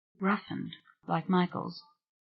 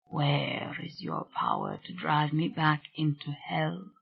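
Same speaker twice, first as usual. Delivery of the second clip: low heartbroken voice; 8 dB quieter than usual. A narrator's reading. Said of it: "same low, vibrant, and heartbroken voice".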